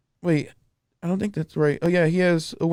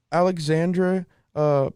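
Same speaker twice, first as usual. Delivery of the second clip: ordinary talk, nothing marked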